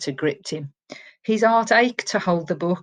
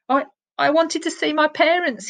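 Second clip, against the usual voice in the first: put-on higher, stammering scared boy; nervous stammer; 9 semitones higher than usual. A narrator's reading. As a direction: get the words out through fear. Frightened boy confesses to Silver.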